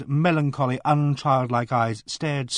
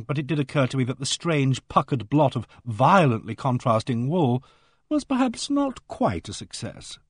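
usual speaking voice

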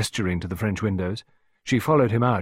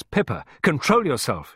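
raised, commanding tone; stern order; 7 semitones higher, 4 dB louder than usual. A narrator's reading. Clarissa seizing Pippa by the shoulders, ordering. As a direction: order a hysterical child to stop. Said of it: "she ordered the child".

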